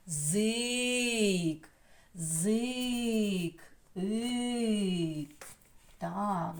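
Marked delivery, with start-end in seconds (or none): 0.1-5.5 ЗИК with a long-held И; stretched syllable demo. Shown as a demonstration. Teacher isolates the second syllable, ЗИК drawn out. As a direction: draw out ЗИК, then just ИК. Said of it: "А тепер другий склад".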